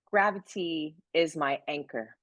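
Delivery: ordinary talk, nothing marked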